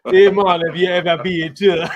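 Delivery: jokey mock Jamaican accent. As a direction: put on a rough Jamaican accent for laughs.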